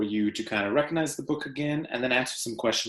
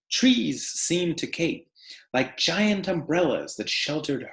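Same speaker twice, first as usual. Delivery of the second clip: story read aloud; 3 semitones higher than usual. Acted. Teacher reading The Tree Lady to her class, expressive read-aloud. Reads picture book to class.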